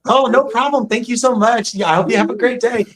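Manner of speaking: high, bright phone-support voice, fast at the end; sweet, high voice for a customer on the phone; customer service voice. Shown as a demonstration. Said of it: "I'm about to put my customer service voice on".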